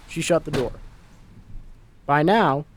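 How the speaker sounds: ordinary delivery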